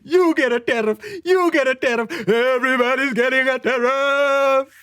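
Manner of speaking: lightly voiced Oprah, handing out prizes; mock Oprah giveaway cheer; a hint of a talk host announcing gifts. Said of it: "going full Oprah".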